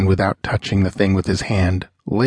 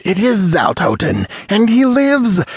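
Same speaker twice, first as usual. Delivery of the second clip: much higher, louder exultant cry; triumphant; 12 semitones higher, 3 dB louder than usual. Acted. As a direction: shout it in wild triumph. Sorcerer's triumph at the resurrection.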